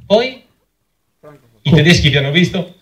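ordinary talk

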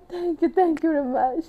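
high-pitched, giddy gratitude; overjoyed thanks. Retold or imitated. Climber thanking her sherpa at the summit, overjoyed. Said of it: "él estaba como súper feliz".

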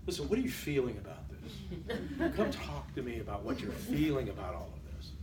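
soft, low, breathy-close touchy-feely teacher; touchy-feely professor. Retold or imitated. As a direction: coo it like an overly caring professor. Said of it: "professors in the sixties and seventies".

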